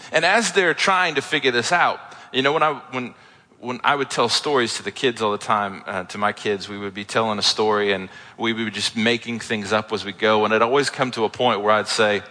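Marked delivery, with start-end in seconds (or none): none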